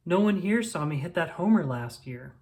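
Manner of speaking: plain reading voice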